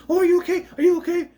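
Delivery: high, loud, gushing dog voice; squeaky concerned mock dog; fretful worried dog. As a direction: fuss over them like an anxious puppy. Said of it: "Dog's like".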